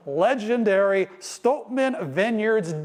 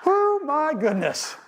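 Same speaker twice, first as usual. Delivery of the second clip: high, slow oh my goodness; delighted surprise; 7 semitones higher, slower than usual. Real, not acted. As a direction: gush with surprise. Reaction after winner announced.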